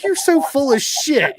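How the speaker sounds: hammed-up wife calling his bluff; wife teasing husband for crying, loud and high. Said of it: "She's like".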